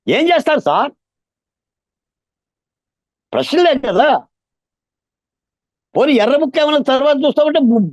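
high, strained pleading from real pain; anguished fervour. Real, not acted. Activist asking the host what justice bereaved families get, anguished. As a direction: plead urgently, pitch right up. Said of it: "వేదన నుంచి వచ్చే తపన sir ఇది".

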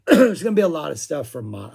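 strained, throat-cleared loud voice; losing voice. Real, not acted. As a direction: push through a failing voice. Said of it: "I'm losing my voice in real time".

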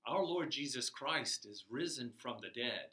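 ordinary talk, nothing marked

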